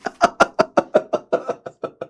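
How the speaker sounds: high, wheezy laughter; helpless laughing fit. Real, not acted. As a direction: laugh till the breath runs out. Laughing at the fight.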